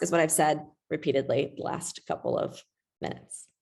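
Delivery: normal talking voice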